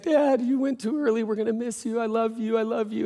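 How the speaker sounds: high, strained mock-crying voice; a hammed-up grieving son, pitch pushed up; imitated sobbing plea. Son at father's casket, high mock-sobbing farewell. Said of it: "just sobbing".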